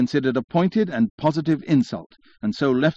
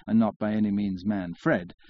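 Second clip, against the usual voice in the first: plain reading voice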